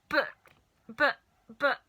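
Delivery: flustered stammer. Acted. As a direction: trip over the words in shock. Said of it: "stammered George's mother".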